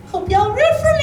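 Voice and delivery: put-on very high, bright anime girl line; high cutesy voice. Shown as a demonstration. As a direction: squeal it up high and loud. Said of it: "my favorite line from the whole show".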